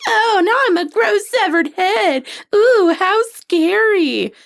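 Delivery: high, very slow, unbothered put-on voice; mock the killer from a severed head; mock bored sarcasm. Buffy's severed head taunting Ghostface, in a high, very slow, unbothered mock voice. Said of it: "she's still being like".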